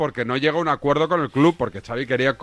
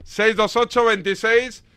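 higher-pitched, projected radio link; radio presenter announcement; 4 semitones higher than usual. Real, not acted. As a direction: announce the segment and phone number to listeners. (locución de radio, tono alto y proyectado)

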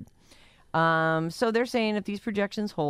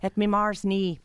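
mock Irish accent; 7 semitones higher, 4 dB louder than usual. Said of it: "talents as an Irish woman".

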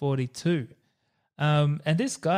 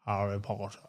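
very low and soft, reaching for richness; attempted rich actor voice; 7 semitones lower, 9 dB quieter than usual. Host trying the actor's rich voice, very low and soft. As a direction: drop right down for a hushed, deep name. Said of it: "I don't have the richness in my voice".

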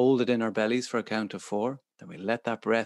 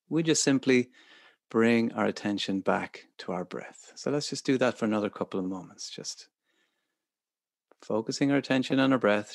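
guided meditation. Acted. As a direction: gently guide focus back to breath. Guides attention back to breath.

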